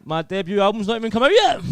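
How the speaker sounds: high, loud panicked yelp; rising to a loud, high wail; mock panic. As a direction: cry out in comic alarm. Comic panic at drive-by.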